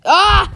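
sudden high yelp; quick startled ah. Real, not acted. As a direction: jump with a short cry. Startled cry in game.

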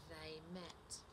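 animated picture-book narration, pitch swinging up; lively storytime voice, key words punched; story reading to children. A narrator's reading. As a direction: read it to little children with big expressive emphasis. Reads a picture book aloud.

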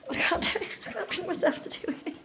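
whispered laugh through the words; breathless laughing. Real, not acted. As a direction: giggle it out under your breath. Laughing while refusing ice bath.

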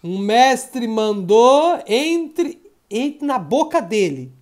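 very slow, stretched-out announcement; drawn-out game call. Pretend. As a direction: stretch each word to build suspense. Anuncia a ordem do jogo. (chamada de jogo bem arrastada)

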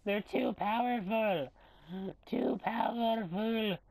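high, drawn-out fake wail; hammed-up despair; mock crying. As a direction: whine it slowly, as if about to cry. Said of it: "I'm gonna cry".

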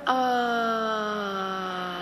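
drawn-out stumped drone; long sheepish "uh". Retold or imitated. Consultant caught out, long groan.

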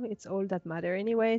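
ordinary delivery

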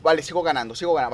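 usual speaking voice

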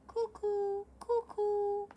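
high sing-song cuckoo; cuckoo call. Acted.

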